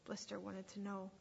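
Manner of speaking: usual speaking voice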